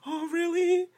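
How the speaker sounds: high, soft feigned interest; mock sympathy. Man acting his reply to her long day, mock sympathetic.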